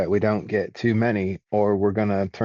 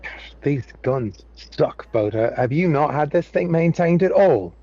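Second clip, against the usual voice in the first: rising annoyed complaint, getting louder; exasperated character complaint; 6 semitones higher, 3 dB louder than usual. Acted. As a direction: gripe at the pilot about the guns. Character complaining about guns.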